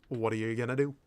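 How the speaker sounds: normal talking voice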